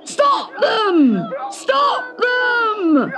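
high, panicky strained shouts; high-pitched cries to stop an escape; roaring guards. Acted. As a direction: yell in alarm from under a duvet. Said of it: "they roared".

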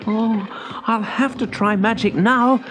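high, desperate resolve; anxious cry. A narrator's reading. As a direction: cry it out when trapped. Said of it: "cried Simeon".

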